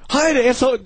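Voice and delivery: high-pitched mock yell; imitated angry order. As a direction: bark it like an exasperated crew member.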